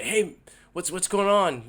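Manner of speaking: excited greeting. Retold or imitated. Dad popping into his young son's room, excited greeting. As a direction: greet your kid eagerly, pitched right up. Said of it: "I'm excited to see him".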